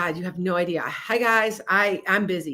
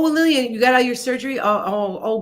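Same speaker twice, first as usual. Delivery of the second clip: ordinary delivery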